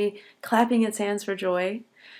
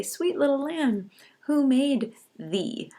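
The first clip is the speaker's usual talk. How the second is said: tender recitation; 7 semitones higher, slower than usual. Shown as a demonstration. Teacher reads poem line tenderly.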